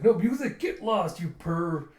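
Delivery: high, loud telling-off; mock outraged yell. Friend scolding stripping flirt, mock outrage. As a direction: chase off a pervert in mock disgust.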